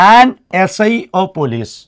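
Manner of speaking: "An" said slowly and clearly before "S I of police"; slow teacher demo stressing "An"; stressed an. Shown as a demonstration. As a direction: lean on "An" as you teach the article. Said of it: "നമ്മൾ ചേർക്കാറുള്ളത് an എന്നാണ്".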